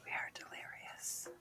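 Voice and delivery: slow unvoiced whisper. Real, not acted. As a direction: whisper it slowly. Whispered tired remark.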